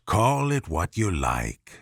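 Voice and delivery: low, sly cat. A narrator's reading.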